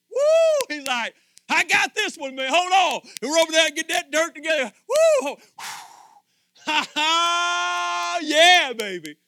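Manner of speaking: high, loud whooping glee; God voiced, loud and high, delighted with his work; exuberant voicing. Voicing Jesus making you, exuberant.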